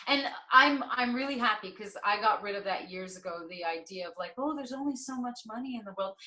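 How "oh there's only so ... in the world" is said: mock scarcity worry. Voices an old belief.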